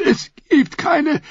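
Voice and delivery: forced out between coughs; coughing. A narrator's reading. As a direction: choke the words out. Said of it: "stieß er hervor".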